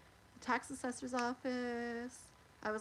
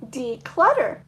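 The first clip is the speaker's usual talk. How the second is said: loud card reveal; 2 semitones higher, 16 dB louder, slower than usual. Real, not acted. Reading card aloud.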